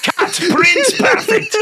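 mock director. Mock director praising actor.

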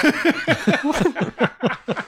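high-pitched burst of laughter; high, sustained real laugh. Real, not acted. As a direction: crack up at your own joke. Laughing at the Uranus joke.

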